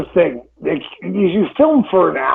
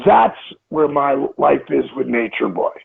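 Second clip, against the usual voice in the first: ordinary talk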